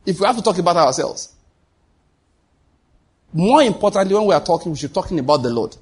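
ordinary talk

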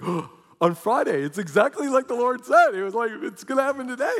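high, loud, rushed gasping excitement; startled realization. Retold or imitated. Patient hearing doctors say Friday, gasping excitedly. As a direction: blurt it out, shocked and thrilled. Realizing the angel's prophecy came true.

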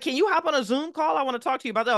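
a breezy vice president asking; hint of a cheery official; retold boss. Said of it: "He's like".

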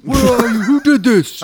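hammed-up search for the attacker; mock frantic yell. Boyfriend shouting at the unseen killer, mock frantic.

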